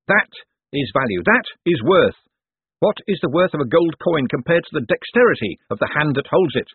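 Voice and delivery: high, projected pitch to a crowd; showman Moist, declaiming. A narrator's reading. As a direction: proclaim it grandly to the onlookers.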